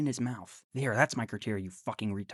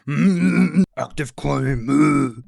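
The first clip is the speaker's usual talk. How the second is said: much louder, blustering mock grunt; mocking Andrew; 12 dB louder, much slower than usual. Mocking Andrew's grumbling reply, loud hammed-up bluster. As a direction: ham up a grumbling ogre. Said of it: "Andrew's like".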